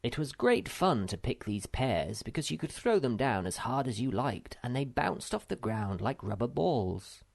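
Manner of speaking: usual speaking voice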